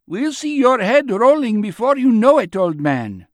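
high, loud heckling threat; called out. A narrator's reading. Onlooker threatening the old man, called out. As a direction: holler the warning from the crowd. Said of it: "someone called out".

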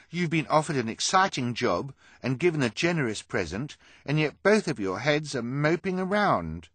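high, loud indignant demand. A narrator's reading. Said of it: "Hugo demanded".

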